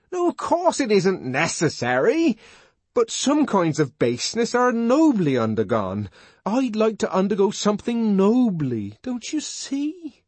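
put-on high, earnest young man's voice, pleading; John the Savage. John pleading with Lenina, high and fervent. John's voice in story.

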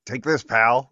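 loud, menacing friendly jab. Retold or imitated. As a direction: snarl out a fake friendly send-off. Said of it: "just be like".

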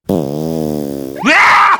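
long low anguished yell; frustrated scream. Acted. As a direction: scream out in exasperation. Frustrated scream at nonsense gases.

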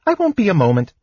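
high, carefree kender voice; cheerful. A narrator's reading. Kender leaving to fetch sword, cheerful. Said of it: "Tass said cheerfully".

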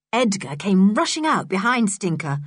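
ordinary delivery, nothing marked

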